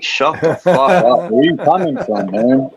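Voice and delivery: slow, put-on scolding of the inner voice; mock stern retort to the craving voice; telling the voice off. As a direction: shut down the temptation, slowly and firmly. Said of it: "I'm like".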